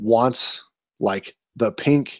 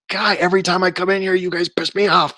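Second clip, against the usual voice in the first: higher and faster exasperated outburst; inner fuming rant; 7 semitones higher than usual. Retold or imitated. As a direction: vent the frustration you hid from the clerk. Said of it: "I was fuming".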